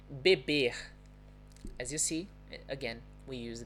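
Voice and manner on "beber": BEBER ending in a throaty H; throat-sound R. Shown as a demonstration. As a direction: say "beber" as "be-BEHH". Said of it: "with this throat sound".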